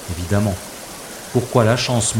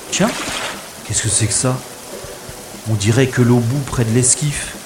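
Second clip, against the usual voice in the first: usual speaking voice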